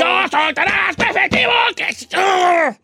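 high-pitched furious shouting; high angry yelling. Pretend. Yelling at band again.

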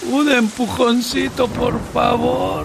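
put-on high, slow slurred drunk calling; town drunk. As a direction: call out like a drunk in the rain. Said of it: "un borracho gritaba".

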